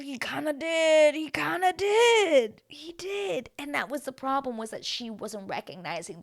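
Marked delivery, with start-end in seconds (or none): 0.0-3.5 high, drawn-out singsong concession; emphatic agreement. Real, not acted. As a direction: stretch it out high, like juicy gossip. Agreeing with the accusation.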